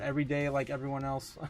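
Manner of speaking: usual speaking voice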